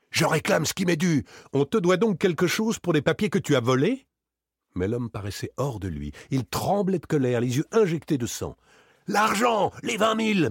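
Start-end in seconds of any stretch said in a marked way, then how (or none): 0.1-4.1 heated quarrel. Acted. Heated quarrel over the money. 9.1-10.5 high, loud, furious stammer; stammering in rage. A narrator's reading. Varin, beside himself, stammers his demand at Daspry. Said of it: "bégaya-t-il".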